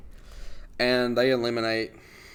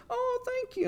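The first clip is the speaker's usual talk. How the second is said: much higher, sugary thank-you; sweet bride voice; 3 dB quieter, slower than usual. Retold or imitated. Bride thanking him for congratulations, high sweet voice. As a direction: flip to a sweet polite bride. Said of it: "And she's like".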